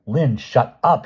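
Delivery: sharp angry order; snapped. A narrator's reading. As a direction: snap at her to silence her. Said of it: "she snapped".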